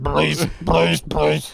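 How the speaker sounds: mock begging, each word set apart; pleading. Acting out begging friend to stop.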